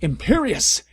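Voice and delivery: hammed-up villain shouting a spell; villain's spell shout. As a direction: fling the curse at her. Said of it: "Voldemort shouted".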